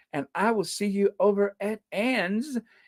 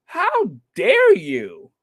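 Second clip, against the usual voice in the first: slow, drawn-out how dare you; hammed-up indignation at the invite; mock outrage; 3 semitones higher, 4 dB louder, much slower than usual. Mock outraged at a seniors' invite. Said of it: "I'm like".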